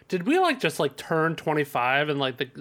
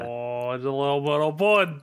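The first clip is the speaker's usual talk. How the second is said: bad New Zealand accent. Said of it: "really bad New Zealand accents".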